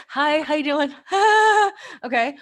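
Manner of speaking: high, loud fake-bright phone hello; forced cheery greeting. Nervous caller answering the phone, fake cheer. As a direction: put on a strained happy voice.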